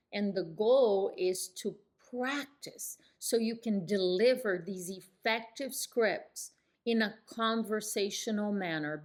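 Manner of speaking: usual speaking voice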